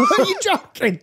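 high, laughing are you joking; laughing in disbelief at the price. Real, not acted. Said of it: "That's what they say here".

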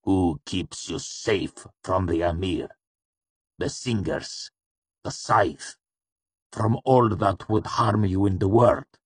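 measured, politely curious questioning; calm politeness. A narrator's reading. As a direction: list them calmly, as if truly wanting the answer. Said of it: "Haliax asked with calm politeness".

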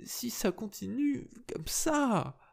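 slow, high, stunned tearful repeat; dazed repetition. A narrator's reading. Esther repeats herself, stunned and tearful. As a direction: repeat it as if in shock. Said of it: "ne réussissait pas à dire autre chose".

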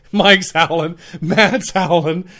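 usual speaking voice

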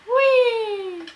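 high squeal of delight; excited whee. Real, not acted. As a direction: let out a gleeful whee. Excited squeal while playing.

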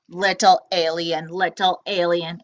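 low looming robot sing-song; robot calling. Acted. Robot coaxing the hiding alien, low and menacing.

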